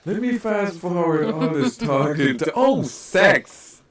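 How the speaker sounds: high, loud, excited discovery; acting out an impatient viewer perking up; eager fast-forwarding. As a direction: land on the find with delighted, high-pitched relish. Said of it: "I'd be like".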